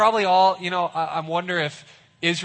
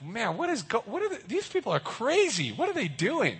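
much higher, disbelieving; baffled onlookers; 7 semitones higher, 3 dB quieter than usual. Retold or imitated. Watchers on the wall scoff at the army, much higher. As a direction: scoff at these crazy people. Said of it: "And they're like".

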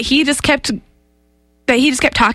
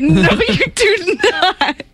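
laughing protest; 5 semitones higher, much slower than usual. Real, not acted. Panicked protest at warning.

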